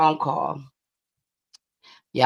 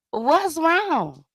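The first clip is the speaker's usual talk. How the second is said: much higher acted question; concerned listener; 11 semitones higher than usual. Host playing herself asking her upset friend, high-pitched concern. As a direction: ask in a high, dramatized voice. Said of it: "That's me".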